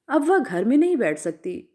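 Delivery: ordinary delivery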